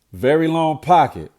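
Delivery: ordinary talk, nothing marked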